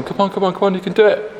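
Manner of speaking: high-pitched mock crowd cheer. Crowd at the finish line to tiring runners, high cheering. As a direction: urge a runner on, pitched high without much volume. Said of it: "cheering us on".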